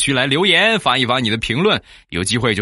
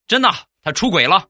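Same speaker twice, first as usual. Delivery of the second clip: friend's answer; 10 semitones higher than usual. Retold or imitated. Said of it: "我说".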